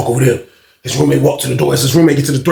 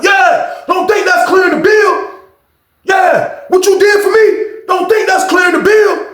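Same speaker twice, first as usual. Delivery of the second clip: angry shout; 13 semitones higher, 3 dB louder, slower than usual. Retold or imitated. Old head turning disrespectful.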